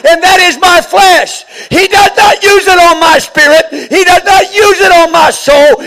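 loud, high, rising preacher's cry; full-force preaching. Acted. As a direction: preach it out to the congregation with fire.

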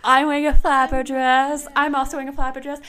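high, loud mock chirp; pretty-girl sketch introductions. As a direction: introduce yourself as a preening pageant girl. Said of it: "they're like".

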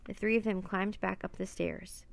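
ordinary delivery, nothing marked

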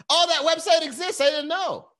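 very high, loud silly voice; squealed, put-on surprise; mock clueless viewer. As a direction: mimic someone dim finding out.

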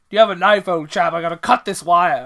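loud, posh officer voice; acting out Nicholson asking for a knife; mock British officer. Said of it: "Nicholson's like".

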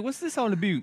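high put-on puzzled voice; mock Canadian listener. Cohost voicing a Canadian listener, high puzzled mock voice. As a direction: ask it like a confused foreign fan. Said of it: "She's like".

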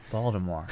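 hushed Baltimore with a D; mumbled local Baltimore. Shown as a demonstration. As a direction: murmur Baltimore as Paldamore. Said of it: "as if it were Voldemort".

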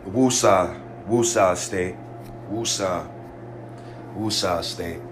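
very low, hushed mantra after a sigh; self-calming. Real, not acted. Worked-up fan calming himself with a mantra, low self-calming. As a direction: breathe out and talk yourself down, softly.

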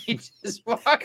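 a shrieking high laugh into words; can't stop laughing. Real, not acted. Friend walks off camera.

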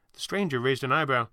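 ordinary delivery, nothing marked

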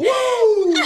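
high whooping cheer; laughing whoop. Real, not acted. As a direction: let out a big laughing woo.

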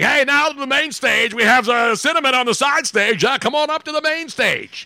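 high, hyped-up strip club DJ patter; strip club announcer. Acted. Host mimicking a strip club DJ calling Cinnamon to the stage, hyped announcer. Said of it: "guy in the strip club".

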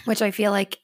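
ordinary talk

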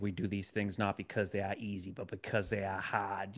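low, soft, measured Boston oratory; JFK. Acted. Old heads imitating a president's speech, stately Boston accent. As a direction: do an old-timer's presidential impression, dropping the R's. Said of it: "they're all trying to do their J F K impression".

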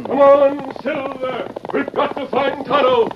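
high, loud rallying call; shouting. Acted. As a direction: call out to the horse at full voice. Hero spurring his horse.

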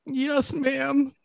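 high, soft, meek yes ma'am; meek reply. Retold or imitated. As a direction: answer like a scolded boy. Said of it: "And I'm like".

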